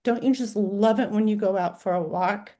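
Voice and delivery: ordinary talk, nothing marked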